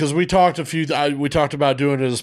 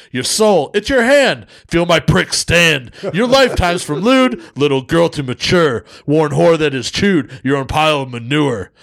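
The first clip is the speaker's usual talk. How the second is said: Def Jam poetry slam; 3 semitones higher, 3 dB louder than usual. Acted. Co-host performing a crude poem for listeners, emphatic slam delivery. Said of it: "I'm gonna do it like a fucking, a Def Jam".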